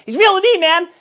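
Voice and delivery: hammed-up gushing fan voice; mock Twitch chat fan. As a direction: shout it like a starstruck fan in chat. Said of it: "Twitch chat's gonna be like".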